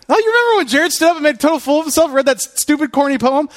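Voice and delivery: high, fast teasing voice; mocking classmates. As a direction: tease him like old classmates. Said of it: "everyone remembers".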